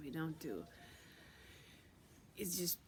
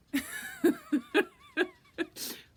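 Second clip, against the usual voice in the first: very high, loud staccato cackle; mocking cackle; 11 dB louder than usual. Being warns it won't be easy, then cackles wildly. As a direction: shriek with derisive laughter. Being laughs when asked why.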